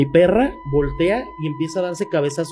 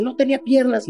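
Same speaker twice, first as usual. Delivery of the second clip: higher, stunned voice; shaken girlfriend; 10 semitones higher than usual. Retold or imitated. Girlfriend realizing they saw the same thing, higher shaken voice. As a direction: say it in disbelief, voice lifted. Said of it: "Y luego me dice".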